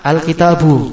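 Al-kitabu with AL in front, ending on bare BU; nunation dropped. Shown as a demonstration. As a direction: finish Al-kitabu on U, leaving off the N. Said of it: "kasih alif lam menjadi apa". (Al-kitabu, berakhir bu tanpa tanwin)

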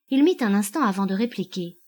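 ordinary delivery, nothing marked

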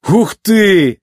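high, impressed exclamation; exclaiming. A narrator's reading. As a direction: burst out after a surprisingly good gulp. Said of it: "воскликнул он".

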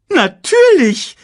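hoch, laut und langsam gedehnt; großspuriges Erdmännchen. A narrator's reading. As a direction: Timon gibt gönnerhaft nach. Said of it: "sagte Timon". (hohes, lautes Natürlich)

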